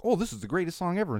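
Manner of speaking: mock gushing fan. Podcaster mocks young fans of seventies songs, gushing. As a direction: gush like a clueless kid. Mocking millennials.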